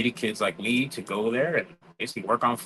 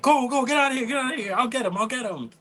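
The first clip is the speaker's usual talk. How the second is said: high, loud hurried orders; frantic principal; 13 semitones higher, 3 dB louder than usual. Retold or imitated. Principal chasing the teen off, high frantic orders. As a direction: urge him out and promise to catch it. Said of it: "he's like".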